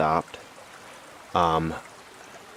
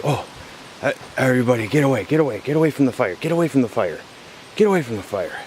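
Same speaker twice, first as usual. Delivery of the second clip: fast, higher, urgent repeated commands; mock panicky order; 5 semitones higher, faster than usual. Leader herding troubled teens from the fire, fast mock alarm. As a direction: hustle the kids off like a flustered leader.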